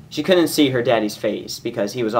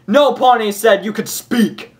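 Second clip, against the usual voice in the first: high, loud barked insult; angry yell; 12 semitones higher, 6 dB louder than usual. Acted. Guards screaming at Derpy on the ground, loud furious yell. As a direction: scream it down at her with contempt. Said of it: "kicked her sides and yelled".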